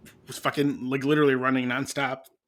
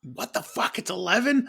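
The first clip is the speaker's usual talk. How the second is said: mock shock, jumping high on the question; retold disbelief; 9 semitones higher than usual. Husband waking late on Father's Day, acted disbelief.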